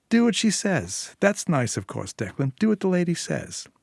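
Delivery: sneering parody of her partner's order; mocking echo. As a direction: throw his own words back at him, mocking. [name] mockingly repeats Declan's words.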